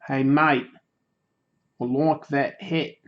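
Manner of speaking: slow, drawn-out mock-Australian accent; amateur Australian accent. Shown as a demonstration. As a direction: try a broad Aussie accent at normal pitch. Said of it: "do an Australian accent".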